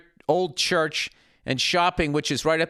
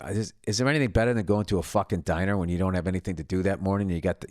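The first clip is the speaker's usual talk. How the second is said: normal talking voice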